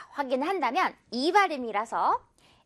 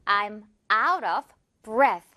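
very slowly, stress on out; slow model sentence; 4 semitones lower, much slower than usual. Shown as a demonstration. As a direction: clear learner-paced delivery. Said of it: "지금 나 숨이 다 떨어졌어". (천천히 또박또박 읽는 예문)

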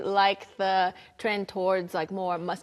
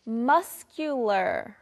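very slow, drawn-out "muscular"; pronunciation model; much slower than usual. Shown as a demonstration. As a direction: stretch "muscular" syllable by syllable. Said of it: "Let's practice our pronunciation".

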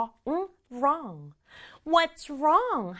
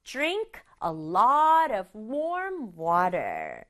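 very slow "Drink a lot of warm water", stress on "lot"; slow model sentence; much slower than usual. Shown as a demonstration. Teacher repeating the sentence for students, very slow. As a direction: read each word slowly. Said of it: "한 번 더".

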